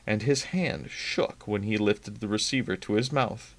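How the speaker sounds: ordinary delivery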